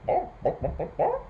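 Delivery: high, staccato bok-boks; hen clucking. A narrator's reading. Storyteller voicing the silly old hen, clucking. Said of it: "the silly old hen clucking".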